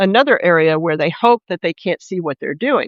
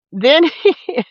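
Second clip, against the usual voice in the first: laughing out of horror; a higher laugh breaking into the words; helpless laugh; 8 semitones higher than usual. Real, not acted. Narrator recounting the tasing, laughing in disbelief. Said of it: "I don't mean to laugh".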